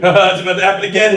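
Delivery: hammed-up "haha" taunt, rushed; mocking bully. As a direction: imitate a bully gleefully piling on. Acting the laughing bully from video.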